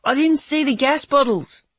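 high panicked scream. Acted. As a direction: scream it in terror. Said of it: "he screamed".

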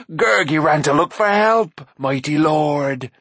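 slow, whiny, groveling beast voice; fawning creature. A narrator's reading. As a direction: grovel to the mighty lords. Gurgi's creature voice.

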